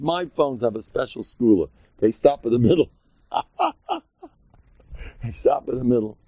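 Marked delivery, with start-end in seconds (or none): 3.3-4.0 high cracking-up laugh. Shown as a demonstration. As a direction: burst into high-pitched laughter. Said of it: "taught me how to laugh at things like this".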